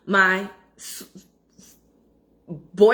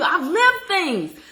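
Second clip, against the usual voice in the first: drawn-out sassy boast; 7 semitones higher, 3 dB louder, much slower than usual. Pretend. Mock proud aside to viewers.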